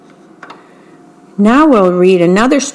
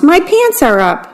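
excited cry; 7 semitones higher than usual. A narrator's reading. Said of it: "cried the old mouse".

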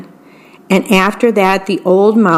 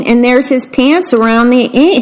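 ordinary delivery, nothing marked

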